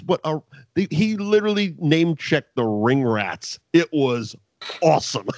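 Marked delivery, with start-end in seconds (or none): none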